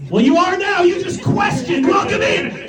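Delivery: high, exclaimed comic verdict; high-pitched mock celebration; mock triumphant welcome. Acted gleeful verdict on the questioner.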